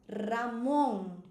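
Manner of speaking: "Ramon" with rolled R and long stressed MON; trilled R, final stress. Shown as a demonstration. Teacher models the name, rolled R, stress at end. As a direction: roll the R and stretch the last syllable. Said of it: "fosse aguda".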